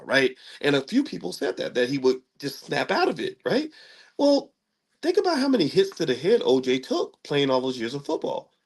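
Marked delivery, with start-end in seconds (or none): none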